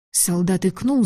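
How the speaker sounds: usual speaking voice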